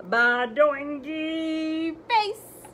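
very slow, loud drawn-out badoingy; stretched out. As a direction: stretch it like a spring. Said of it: "makes silly sounds like".

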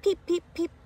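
high peep peep peep; chick's peeping. A narrator's reading. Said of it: "says Little Chick".

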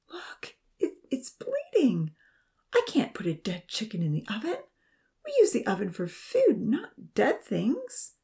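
high, squeamish, upset protest; pleading protest. A narrator's reading. Nancy protesting at cooking a dead chicken, pleading. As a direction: plead in disgust, stretching the last words. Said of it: "Nancy pleaded".

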